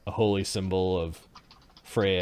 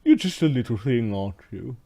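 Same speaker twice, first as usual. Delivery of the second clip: haughty slave master; 3 dB louder than usual. Acted.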